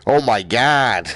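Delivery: slow, hammed-up broad New York accent; attempted New York accent. Gamer imitating a comedian's old Bronx voice, rough broad New York accent. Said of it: "really, really broad New York accent".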